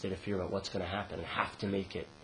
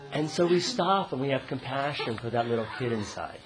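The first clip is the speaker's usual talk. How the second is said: ordinary talk, nothing marked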